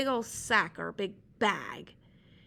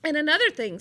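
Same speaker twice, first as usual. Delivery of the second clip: louder indignant call; Sea Slug scolding; 4 semitones higher, 8 dB louder, much faster than usual. Acted. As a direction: keep telling him off. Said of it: "said Sea Slug".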